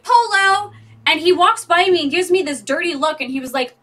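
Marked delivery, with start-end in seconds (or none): 0.0-0.7 loud, high answering Polo; calling back. Retold or imitated. Answering a stranger's call in the store, loud and high. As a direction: call back playfully across the aisles. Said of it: "and I was like".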